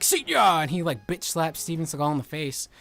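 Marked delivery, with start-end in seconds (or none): 0.0-0.6 very high, loud gleeful taunt; cheeky shouted goodbye; mock hero taunt. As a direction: yell it like a kid's TV hero. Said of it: "Tommy, and he's like".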